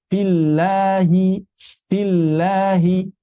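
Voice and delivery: 'fillahi' slow, with a light front 'lah'; thin lam. Shown as a demonstration. Teacher shows lam after kasrah, thin. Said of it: "Kalau sebelumnya kasrah".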